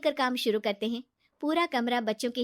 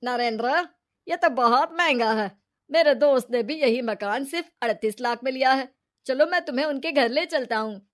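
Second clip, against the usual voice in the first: louder, firm man's voice objecting to the price; brother-in-law voice; 2 semitones higher, 7 dB louder than usual. A narrator's reading. Brother-in-law warning Narendra about the overpriced house, firm and loud. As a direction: voice the sister's husband, firm and loud. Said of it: "तभी नीरू का पति बोलता है".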